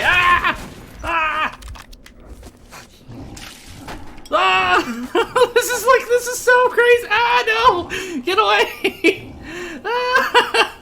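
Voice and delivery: high laughing panicked shrieks; hysterical screaming. Real, not acted. Hit by everything, screaming.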